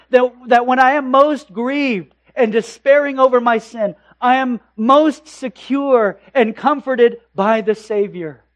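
loud, high preaching climax; full-force preaching. Acted. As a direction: proclaim it from the pulpit, rising.

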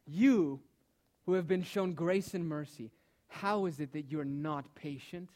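preacher confronting the congregation, rising and forceful; impassioned preaching. Real, not acted. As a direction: drive each question home to the congregation.

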